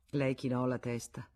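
plain reading voice

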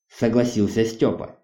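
ordinary delivery, nothing marked

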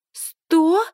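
high, startled disbelief; shocked outburst. A narrator's reading. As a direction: jump up in shock. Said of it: "подскакивает девица в розовом".

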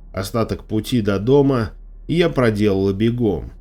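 plain reading voice

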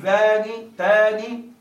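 ذان تان with a short kasra nun, very slow; single nun. Shown as a demonstration. Teacher showing the kasra form, very slowly. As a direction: draw out ذان تان with a light, undoubled nun. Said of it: "بكسر النون بدون تشديد".

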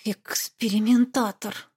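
slow grumbled word; grumbling. Acted. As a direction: grumble it out slowly. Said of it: "Проворчала я".

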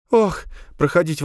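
high, fluttery nervous mother; flustered nervous woman. Acted. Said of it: "Она сильно разнервничалась".

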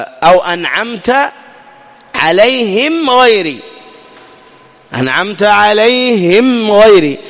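أنعمت عليهم with lips closed briefly on each meem; slow recited أنعمت عليهم غيري; clear meem, slow recitation. Shown as a demonstration. Teacher recites the verse slowly to show the meem. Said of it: "مجرد أنك تطبق الشفتين".

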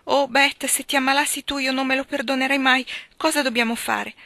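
put-on a frightened girl begging what to do; fearful outburst. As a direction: panic for your sister's life. Joe frightened for sister.

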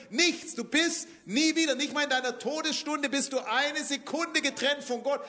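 fiery sermon climax; preaching at full force. Acted. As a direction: build it up and proclaim each word.

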